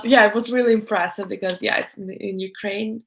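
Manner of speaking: ordinary delivery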